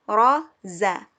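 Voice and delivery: Ro and za said loud and distinct; teacher contrasting Ro and za; letter contrast. Shown as a demonstration. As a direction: sound out Ro, za for the class. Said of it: "huruf ro dan zai".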